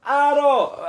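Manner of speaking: high, loud call of Arrow; calling horse. Retold or imitated. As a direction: bellow Arrow like the game hero. Imitating the game character calling.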